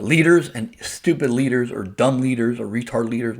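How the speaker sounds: ordinary delivery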